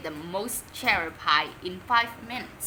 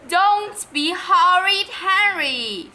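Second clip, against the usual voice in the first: higher, louder, slow scolding shout; angry shout; 8 semitones higher, 10 dB louder, slower than usual. Acted. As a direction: mom scolds the boy as she storms in. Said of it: "shouted Mom".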